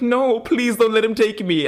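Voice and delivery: put-on high, pleading voice of a scared girl; squeaky mock plea for help; Elena pleading. Retelling Elena begging not to be taken, pitched high and pleading. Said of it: "And Elena's like".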